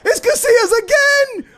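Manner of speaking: high, loud yell of disbelief; excited shout. Real, not acted. Gamer shouting as his keeper saves again, excited. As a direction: shout the keeper's name in relief. Keeper makes another save.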